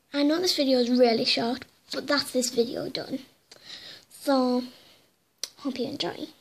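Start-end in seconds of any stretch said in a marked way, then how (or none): none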